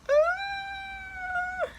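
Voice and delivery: very high squeaky whimper; scared whimper. Acted. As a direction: whimper in fear. Scared Bob in transporter.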